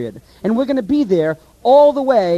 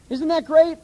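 ordinary delivery

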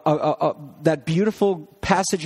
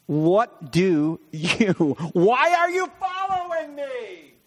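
slow, stretched-out, rising to a high pitch; hammed-up puzzled question, slowly climbing; mock puzzled questioning; 5 semitones higher, much slower than usual. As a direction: draw it out in high-pitched bafflement. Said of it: "we would paraphrase this like".

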